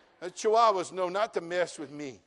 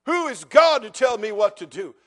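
louder, sneering repeat of Pharaoh's boast; mocking Pharaoh; 9 dB louder than usual. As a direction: ham up the arrogant challenge, punching God. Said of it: "Oh, he'll regret that statement".

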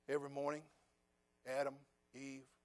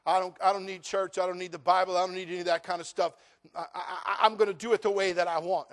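defiant; 4 semitones higher, 11 dB louder than usual. Retold or imitated. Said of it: "I had someone tell me".

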